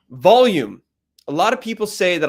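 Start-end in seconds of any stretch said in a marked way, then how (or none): 0.1-0.8 punched out loud; one big, loud word. Shown as a demonstration. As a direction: announce it with a burst of volume. Demonstrating volume in its title.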